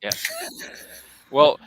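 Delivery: normal talking voice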